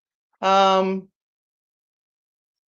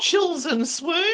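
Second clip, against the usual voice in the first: 1 swooning title; 7 semitones higher, much slower than usual. Pretend. Mock title pun.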